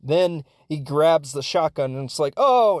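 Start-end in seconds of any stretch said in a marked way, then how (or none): 2.4-2.8 loud, high startled oh; shocked gasp. Retold or imitated. Audience watching the commercial, loud shocked oh. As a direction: gasp it out like a horrified crowd.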